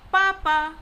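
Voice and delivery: "Papa" loud and stressed; fourth-tone demonstration of "Papa"; falling tone. Shown as a demonstration. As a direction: hit both syllables of "Papa". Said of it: "the falling tone".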